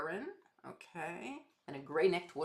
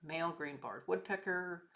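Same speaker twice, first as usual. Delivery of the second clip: usual speaking voice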